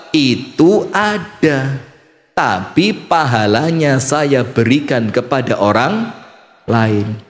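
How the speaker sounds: slow, low, measured reply; slow solemn answer. Acted. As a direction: answer calmly and gravely. Allah answering calmly. (jawaban pelan dan khidmat)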